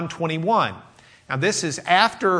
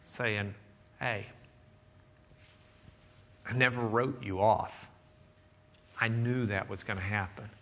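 tender; 5 semitones lower, 9 dB quieter than usual. Retold or imitated. Tender Lord restoring Peter.

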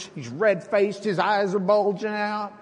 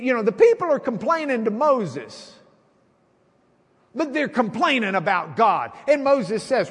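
louder, higher sermon voice; preaching; 7 semitones higher, 5 dB louder than usual. Acted. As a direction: preach it out with fervor. Preaching at full force.

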